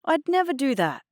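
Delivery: high-pitched injured innocence; feigned hurt. A narrator's reading. Adnan denying it to Mum, feigning hurt. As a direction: protest as if wounded. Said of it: "Adnan put on a hurt face".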